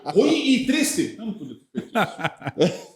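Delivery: slow, drawn-out laughing groan of dismay; laughing lament. Real, not acted. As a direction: stretch it out slowly while laughing. Laughing mock lament of a bad scene.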